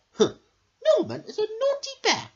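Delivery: high, bright queen bee voice. Acted. The queen bee greets her pupils at school. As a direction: greet the class like a grand queen. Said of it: "said the queen".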